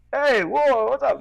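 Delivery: high, loud whoa, glad and surprised; a tatted guy lighting up at a fellow local; excited local greeting. Retold or imitated. The driver in the SUV greets him back. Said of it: "He goes".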